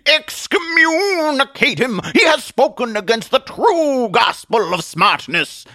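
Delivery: high, loud preacher's wail; hammed-up zealous preacher in full cry; fanatical denunciation. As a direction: condemn the heretic with drawn-out fervor. Atheist denounces a heretic.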